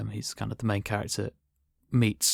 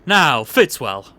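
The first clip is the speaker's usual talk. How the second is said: booming, jovial posh boss voice; hearty boss, higher and louder, lording it over the clerk; pompous boss; 7 semitones higher, 10 dB louder than usual. Acted. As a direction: play the bluff, overbearing company boss. S0 plays the boss Mr Bathurst in the sketch.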